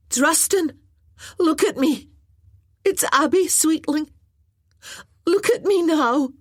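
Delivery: high, loud urgent plea; urgent call. Acted. Maggie calling to Druston on the scaffold, loud urgent plea. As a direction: call out to him sharply. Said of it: "tried to gentle her voice".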